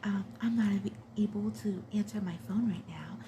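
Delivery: hushed, breathy, seductive whisper; seductive voicemail. Retold or imitated. Mother's outgoing voicemail greeting, seductive. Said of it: "like, so seductive. It was like".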